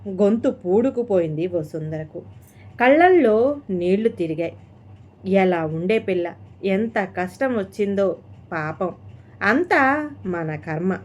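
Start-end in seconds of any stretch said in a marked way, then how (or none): none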